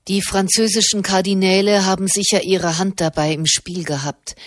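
ordinary delivery, nothing marked

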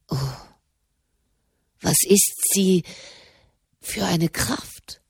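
awed whisper. Acted. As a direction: sigh it out in quiet awe of her power. Marconi marvels at her strength.

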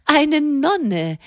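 high, amazed exclamation; astonished. A narrator's reading. Madame, amazed the letter is from a nun, exclaims. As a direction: gasp out the word in delighted surprise. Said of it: "rief Madame erstaunt".

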